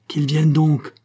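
grand, defiant challenge; proud exclamation. A narrator's reading. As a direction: cry it out with swagger. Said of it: "s'écria Roland d'un ton superbe".

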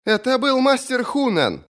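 high, drawn-out female character voice; slow, high sister's voice; sister announcing news. A narrator's reading. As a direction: deliver it slowly, pitched right up.

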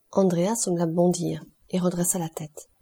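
plain reading voice

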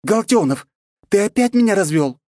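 high, loud outraged question; indignant. A narrator's reading. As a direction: scold him for fooling you. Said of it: "она сказала возмущённо".

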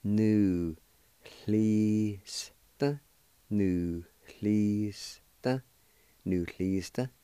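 Nu, chli, s, t apart, then Nucleista whole; syllables then whole word. Shown as a demonstration. Teacher building the word up syllable by syllable for learners. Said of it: "and it's pronounced like this".

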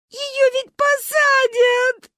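high, loud slow sobbing wail. A narrator's reading. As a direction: sob out the fear. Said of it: "рыдала Маня". (рыдая)

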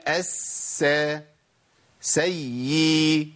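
السيئات broken in parts, الس- then سيء, each held; very slow, dissected الس- and سيء; separated doubled letters. Shown as a demonstration. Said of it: "أربع حروف".

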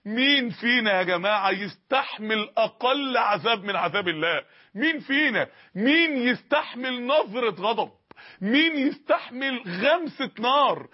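slow, weighty questions with مين stressed; solemn drawn-out preaching; slow rhetorical questions. Acted. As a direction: lean hard on each مين, slowly.

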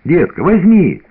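put-on high, pleading child's whine; pestering child. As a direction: beg like an eager little boy. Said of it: "клянчил Артёмка".

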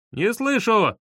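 high, perky call through a door; cheerful shout back. A narrator's reading. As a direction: sing out brightly that you can't hear. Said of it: "бодро переспросил Витька".

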